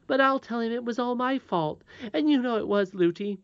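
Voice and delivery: put-on high-pitched child, insisting; earnest little princess. Princess offering to take blame, earnest. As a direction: promise it like a loyal little girl.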